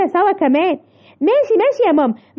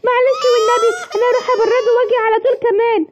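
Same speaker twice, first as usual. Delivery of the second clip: crying; 6 semitones higher than usual. Acted. Child scolded, sorry and upset.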